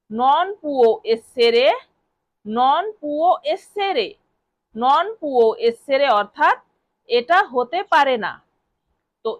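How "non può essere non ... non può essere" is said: very slow repeated phrase; slow drill. Shown as a demonstration. Teacher drilling non può essere for learners, very slow. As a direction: stretch it so students can follow. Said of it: "এই বাক্যটিকে আমরা Italian ভাষায় বলবো".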